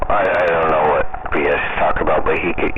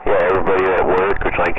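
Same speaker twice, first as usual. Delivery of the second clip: normal talking voice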